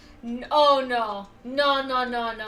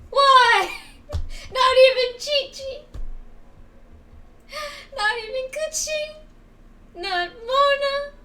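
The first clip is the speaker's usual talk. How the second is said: high, broken laughing sob; real laughing cry; laugh-crying lament; 11 semitones higher, 5 dB louder than usual. Real, not acted. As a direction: laugh through despair. Laugh-crying over missing Mona.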